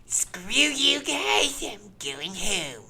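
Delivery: high, drawn-out cartoon brat voice; Eric Cartman. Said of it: "Here's Eric Cartman from South Park".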